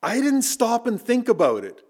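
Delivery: normal talking voice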